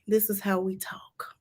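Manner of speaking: soft breathy whisper, almost no voice; whispered aside. Real, not acted. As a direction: lean in and whisper it like a secret. Georgia peach defends slow talk.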